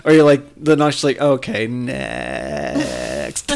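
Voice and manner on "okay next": low, hugely drawn-out dismissal; mock unimpressed. Cohost imitates a listener put off by obnoxiousness, drawling dismissively. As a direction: stretch "next" way out, dropping low.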